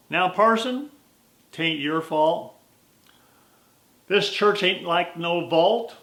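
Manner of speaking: put-on slow folksy old cowboy; lightly voiced old cowhand, unhurried; cowboy drawl. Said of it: "his Western drawl".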